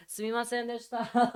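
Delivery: high-pitched Japanese apology, as if bowing; put-on high, deferential voice; mock-humble customer-service apology; apologetic Japanese Alexa. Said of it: "if she were a person, that's what she'd be doing".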